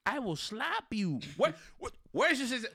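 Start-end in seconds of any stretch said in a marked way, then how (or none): none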